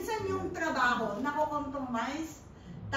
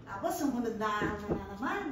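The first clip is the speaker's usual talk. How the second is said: ordinary talk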